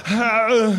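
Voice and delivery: drawn-out guttural "how, how"; strained Arnold grunt, twice; Schwarzenegger-style yell. Acted. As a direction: groan it out like a straining action hero. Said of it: "he went just like this".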